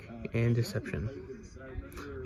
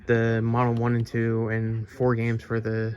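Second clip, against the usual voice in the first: usual speaking voice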